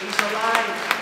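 loud, ringing proclamation; a joyful cry of Easter victory; triumphant shout. Real, not acted. Preacher proclaiming the resurrection.

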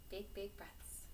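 gentle whispered breathing cue; soft breathy whisper. Shown as a demonstration. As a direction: breathe the words out softly. Breathing exercise with class.

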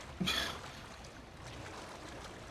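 silent wheezing laugh; breathy giggle. Real, not acted. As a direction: wheeze with laughter after fright. After the jump scare.

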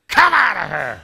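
loud casting-out command; shouted command. Retold or imitated. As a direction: bellow it as an order. Retold shouted command to cast out.

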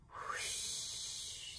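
breathy drawn-out whoosh; soft whoosh sound. A narrator's reading. Boggin answers Charles with a soft wind whoosh. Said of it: "said Boggin".

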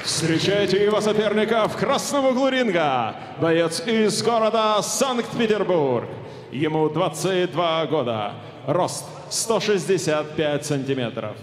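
rising arena announcement; boxing ring announcer. Real, not acted. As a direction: build hype toward the name. Ring announcer builds up fighter.

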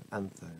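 "antho" with a soft th; th pronunciation. Shown as a demonstration. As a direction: test "antho" as AN-tho. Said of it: "is that anth or ant?".

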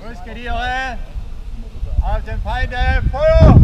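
loud battle cry, building to a yell; shouted charge command. Real, not acted. Drill, charge at the enemy.

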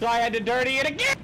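fast, high complaint climbing to a yell; mock-anguished gripe; exasperated complaint. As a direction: blurt it out in rising exasperation. Exasperated retelling of redoing work.

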